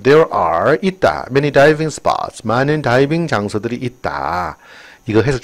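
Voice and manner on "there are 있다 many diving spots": very slow, clear English read; slow textbook reading; slow reading aloud. Shown as a demonstration. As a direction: read it out word by word for the class. Reads English sentence aloud.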